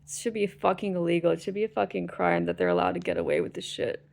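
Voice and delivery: ordinary talk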